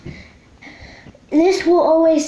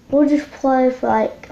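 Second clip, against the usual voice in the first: usual speaking voice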